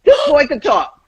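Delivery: retold parental disbelief; amazed. Both parents' amazed reaction retold.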